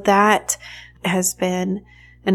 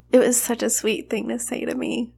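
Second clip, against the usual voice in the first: higher, moved and tearful; choked up; 4 semitones higher, 4 dB quieter than usual. Real, not acted. Mother touched by her massage therapist's words, choked up. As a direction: say it through a real lump in the throat. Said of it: "getting choked up here".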